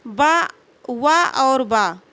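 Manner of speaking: "बा, वा और बा" said very slowly, each syllable separate and clear; contrasting sounds demo. Shown as a demonstration. Teacher contrasting वा and बा for students, very slowly. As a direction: set "वा" against "बा" slowly so the lip sounds contrast. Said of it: "वा और बा का उच्चारण स्थान".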